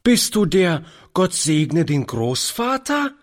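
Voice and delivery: put-on high, lively child's question; little girl piping up in a high voice. A narrator's reading. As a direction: call it out like an eager small child. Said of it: "rief die Kleine lebhaft".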